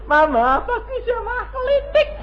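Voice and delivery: put-on high, whiny child voice; child's voice. Preacher mimicking a neighbour's child, high and whiny. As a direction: squeak it like a small kid.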